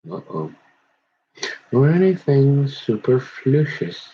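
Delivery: measured, solemn Bible reading; scripture reading. Acted. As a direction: read it like a preacher at the pulpit. Reads scripture aloud, solemn.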